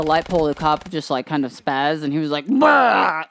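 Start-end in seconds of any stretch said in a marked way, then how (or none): 2.5-3.2 a sudden startled bah, louder; jolted barking bah of surprise; weird startled sound. Retold or imitated. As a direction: mimic the cop spazzing out with a yelp. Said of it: "did this weird sound".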